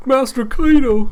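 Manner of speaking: a sudden high, loud call to the boss; excited cry. Acted. Minions crying out as their boss arrives, high and loud. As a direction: cry out the name with a jump up in pitch.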